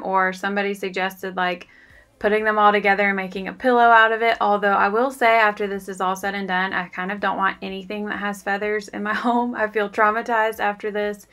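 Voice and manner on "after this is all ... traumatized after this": ordinary talk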